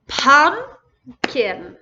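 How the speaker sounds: Pumpkin split as pump, pause, kin; loud first beat of Pumpkin, then a gap before kin; broken into syllables. Shown as a demonstration. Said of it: "Let me say that again".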